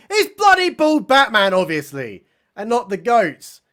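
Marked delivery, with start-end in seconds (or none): 0.1-1.5 high, loud mock exasperation; emphatic blurt. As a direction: burst out with the blindingly obvious answer. Narrator blurts out the obvious culprit.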